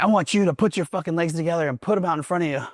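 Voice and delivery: high mock order, drill sergeant style; barking drill instructor. Drill instructor ordering recruit on the toilet, mock barking. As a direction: bark the order like a DI.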